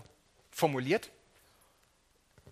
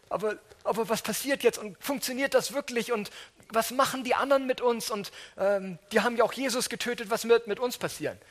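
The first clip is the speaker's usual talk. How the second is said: higher, anxious, tumbling questions; frightened disciples; 8 semitones higher, 4 dB louder than usual. Acted. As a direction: panic behind locked doors.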